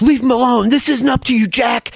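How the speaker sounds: high-pitched shouted intervention; yelling. Acted. Sawyer interceding with Jack, yelling. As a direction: yell to break up the fight. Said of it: "like, yelling, Sawyer interceding".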